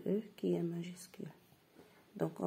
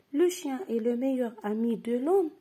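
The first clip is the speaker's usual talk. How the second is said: higher and louder repeat; emphatic repeat; 5 semitones higher, 7 dB louder, faster than usual. Shown as a demonstration. Teacher repeating the sentence higher and louder for pupils. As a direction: say it again loud and bright. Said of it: "Je répète".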